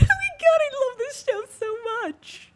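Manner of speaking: very high, loud squeal of delight. Retold or imitated. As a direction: squeal like an overexcited fan. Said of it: "squealing out loud".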